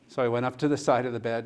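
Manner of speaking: normal talking voice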